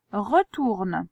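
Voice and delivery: each word read out clearly for writing; dictation voice. Shown as a demonstration. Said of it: "Donc c'est retourne".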